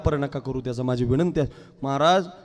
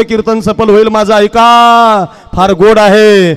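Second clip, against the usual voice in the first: full-force loud, raised kirtan preaching; 5 semitones higher, 14 dB louder than usual. Real, not acted. Preacher to congregation on pure devotion, impassioned loud preaching. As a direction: drive each phrase home to a held last word.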